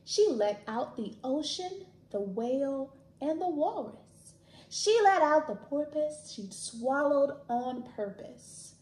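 lively storybook read-aloud; animated picture book reading; reading to children. Acted.

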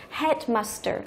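"headmaster" with the main stress on head. Shown as a demonstration. Teacher shows students compound-noun stress, stress on "head" in "headmaster". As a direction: stress the first part of "headmaster". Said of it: "trọng âm chính của từ".

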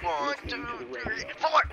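nervous counting. Real, not acted.

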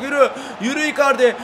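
loud, high yelling of the striker's name; urging shout. Real, not acted. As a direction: drive him on with a stretched cry. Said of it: "gol olacak diye bağırıyorum". (Icardi'yi gole çağıran bağırış)

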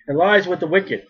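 normal talking voice

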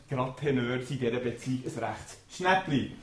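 ordinary talk

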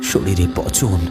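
plain reading voice

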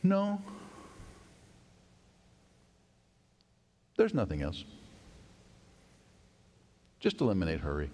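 deliberate, dropping low after the first word; long-paused reply. Retold or imitated. Old gentleman answering the hurried young pastor, deliberate. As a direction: leave long silences between phrases. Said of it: "after a pause for a long time".

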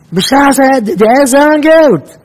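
very high, loud exclamation; hammed-up cry of outrage; acted outcry. Acted outcry mocking the guest.

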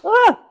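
high sharp squeal at a miss; squeal of dismay. Real, not acted. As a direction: yelp as it fails. Missed throw.

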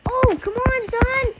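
very high, loud complaint; real high-pitched outburst; frustrated yell. Real, not acted. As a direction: shout in exasperation at the game. Frustrated in game.

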